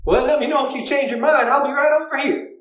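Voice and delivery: put-on flirt hard with the cat in a Southern accent; high, bawdy Southern flirt; flirty Southern accent. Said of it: "trying to find an accent for her".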